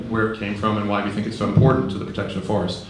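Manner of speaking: ordinary talk, nothing marked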